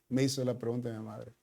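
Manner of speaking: normal talking voice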